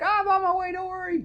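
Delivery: high, squeaky, reassuring voice; squeaky Peter. Retold or imitated. Peter squeaking reassurance to Gwen on the phone. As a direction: chirp it like a guilty boyfriend.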